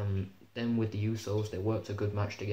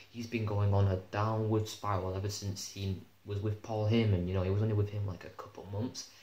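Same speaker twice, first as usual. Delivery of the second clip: ordinary talk, nothing marked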